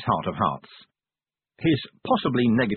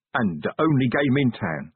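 plain reading voice

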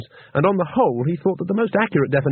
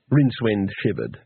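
usual speaking voice

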